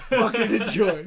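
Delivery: laughing, mock-gleeful taunt; laughing loud taunt. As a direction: fling it at them mid-laugh. Blasting Napalm Death at coworkers.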